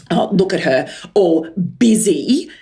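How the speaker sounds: slow, sneering mock of the busy mum; snide remark. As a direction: drawl it out condescendingly. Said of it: "she says to the dry cleaner lady".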